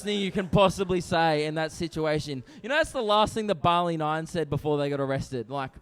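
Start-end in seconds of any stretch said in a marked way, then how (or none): none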